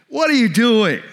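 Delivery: acted-out outburst of exasperation; exasperated. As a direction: imitate someone angrily challenging a useless leader. Acting out the angry disciples' complaint.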